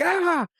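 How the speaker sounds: high mock yelp of fright; startled scream. Kid feeling a cockroach, high startled yelp. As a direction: yelp as a bug tickles you. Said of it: "I was like".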